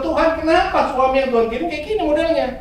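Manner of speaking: meniru istri yang mengeluh, suara tinggi; keluhan istri. Retold or imitated. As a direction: rengekan istri, diperankan ringan. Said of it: "Istri saya mungkin di rumah bilang". (istri mengeluh kepada Tuhan soal suaminya, dengan suara tinggi merengek)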